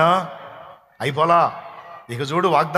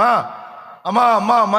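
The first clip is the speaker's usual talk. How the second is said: mock child's cry; 5 semitones higher than usual. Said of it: "అని కేకలేసింది అనుకో".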